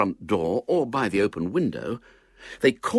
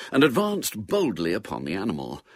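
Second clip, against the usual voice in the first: ordinary delivery, nothing marked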